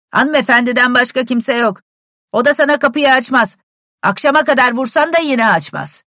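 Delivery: loud, high shout across the yard; calling out. A narrator's reading. Servant yells directions from the stable window, loudly. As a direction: bellow directions to the visitor outside.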